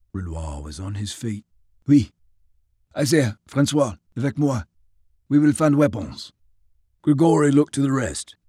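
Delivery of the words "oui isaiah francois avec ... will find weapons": put-on eager Frenchman, French accent; rally your men in French accent. Renoir voiced, French.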